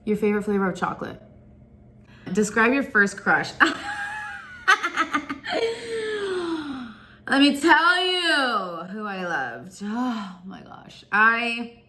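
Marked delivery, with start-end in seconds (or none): 3.6-6.9 high shrieking giggle; real giggly shriek. Real, not acted. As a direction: squeal with embarrassed laughter. Asked about first crush.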